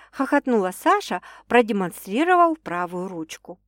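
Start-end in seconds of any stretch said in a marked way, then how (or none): none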